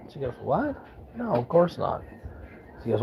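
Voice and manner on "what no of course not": high, startled what, then a mild no of course not; taken aback, brushing it off; surprised denial. Retold or imitated. Wife surprised at accusation.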